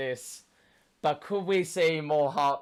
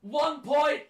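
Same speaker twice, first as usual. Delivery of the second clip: excited; 11 semitones higher, 4 dB louder than usual. Real, not acted. Fan after goal.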